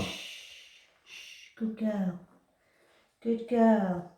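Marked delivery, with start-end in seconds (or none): 0.0-2.2 slow, soft hush to the cat; calm her down gently; drawn-out soothing praise. Real, not acted.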